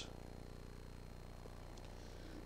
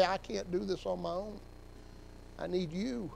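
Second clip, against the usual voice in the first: quiet plea; 11 dB quieter than usual. Pretend. Voiced prayer of surrender.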